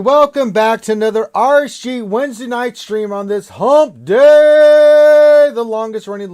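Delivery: loud, high, drawn-out host greeting; hyped show opener. Real, not acted. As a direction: belt out the welcome, stretching the words. Host opens the live show.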